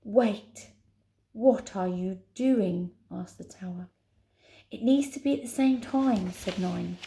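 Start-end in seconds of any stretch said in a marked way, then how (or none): 0.0-2.9 tower. Acted. Voices the tower. 4.7-6.3 raised, pressing call; urgent. Acted. Heroine urgent in danger.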